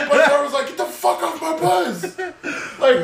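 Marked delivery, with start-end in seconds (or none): 0.7-2.0 high, slow, laughing rebuke; mock outraged order. Bus driver throws fugitive off, mock outraged. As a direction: stretch it out high, hamming up the outrage.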